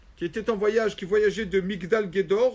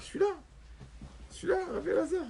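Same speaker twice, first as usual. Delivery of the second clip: scoffing disbelief; 12 semitones higher, 4 dB quieter, faster than usual. Acted. Ugly man scoffs in disbelief.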